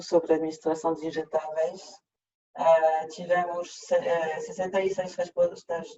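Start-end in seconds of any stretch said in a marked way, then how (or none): none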